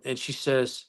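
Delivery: normal talking voice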